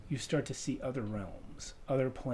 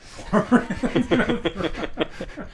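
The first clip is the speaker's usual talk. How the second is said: laughing; 7 semitones higher, 9 dB louder, much slower than usual. Real, not acted. Mock repeat with laugh.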